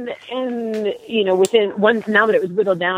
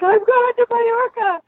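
very high, squealed re-enactment of joy; excited shriek. Retold or imitated. Finalist telling boyfriend she made it, excited mock shriek. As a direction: squeal the big news at your boyfriend. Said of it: "I started screaming".

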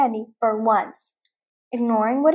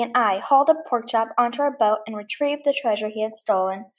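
plain reading voice

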